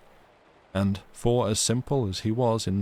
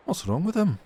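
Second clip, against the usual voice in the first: maid's curious question; 11 semitones higher than usual. Acted. Maid asking about sick lad.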